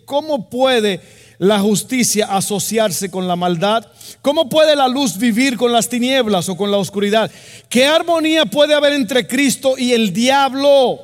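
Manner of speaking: loud, high, slow declamation of scripture; ringing pulpit delivery, stretched out; full-force preaching. Real, not acted. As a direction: drive each rhetorical question home to the congregation.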